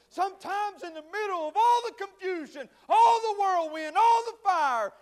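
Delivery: high, loud, mounting list; full-force preaching. Real, not acted. Preacher piling up the confusion and fire, full-force preaching. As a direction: build the storm to a shout.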